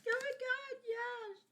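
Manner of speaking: very high gushing squeal; friend's high squeal. Retold or imitated. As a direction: squeal it like an excited fangirl. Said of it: "she's like".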